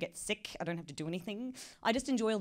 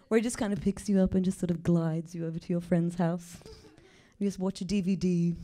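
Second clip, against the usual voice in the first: stoner Google Maps. Pretend. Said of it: "stoner Google Maps".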